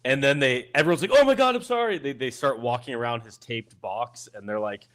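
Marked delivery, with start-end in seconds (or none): 1.1-2.0 much higher, flustered mock sorry; passer-by caught stepping in the box; startled apology. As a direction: squeak out a hasty apology.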